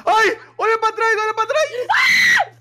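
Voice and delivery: high panicked screaming; being chased. Real, not acted. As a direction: shriek a warning in terror. Player sees monster behind them.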